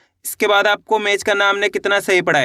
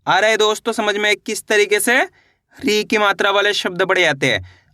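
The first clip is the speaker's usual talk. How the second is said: ordinary talk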